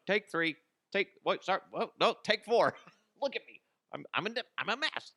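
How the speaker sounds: high, hammed-up fluster; flustered rehearsing boss. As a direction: botch the take in a high mock panic. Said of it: "him rehearsing that whole thing".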